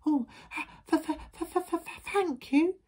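high, stuttering little-wolf voice; scared stammer. A narrator's reading. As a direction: stammer nervously before getting the word out. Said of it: "who was still a bit scared".

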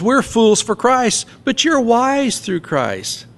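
high, slow mocking contrast; sarcastic. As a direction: stretch it out with heavy irony. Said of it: "he gets sarcastic again".

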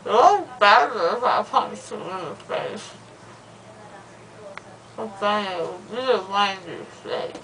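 loopy slurred rambling; drugged, drowsy talk; after wisdom teeth. Real, not acted.

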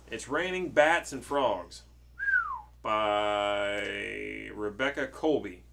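slow, loud, drawn-out title reading; dramatic title announcement. Acted. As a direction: announce the book grandly, stretching the words. Announces the book's title to child.

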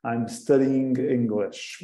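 slow, clear I'm studying English; slow model sentence. Shown as a demonstration. Teacher repeating the example slowly for the class. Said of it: "pomos o ing depois do Y".

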